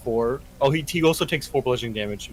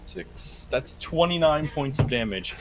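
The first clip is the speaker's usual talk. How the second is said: ordinary talk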